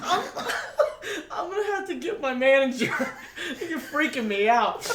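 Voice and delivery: fast, giggling through it; barely holding back laughter; laughing. Real, not acted. Employee keeps laughing.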